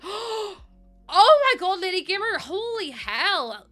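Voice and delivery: gasp, then a high, loud, elated cry; real surprised excitement. Real, not acted. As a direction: blown away by a gift. Reacting to a big donation.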